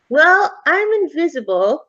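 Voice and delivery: put-on high, boyish explaining voice; chatty ghost boy. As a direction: explain it like an earnest kid.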